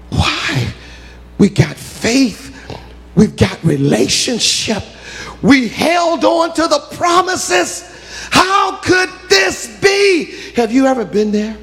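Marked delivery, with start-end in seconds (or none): 0.1-10.3 high slow shouted lament; anguished cry. Real, not acted. Preacher lamenting to the church, shouted high and slow. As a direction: cry out the question to heaven.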